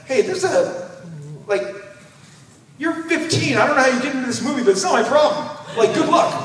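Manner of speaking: high, chirpy brush-off; cheerfully wash your hands of it; breezy dad. Retold or imitated. Dad dropping kids off.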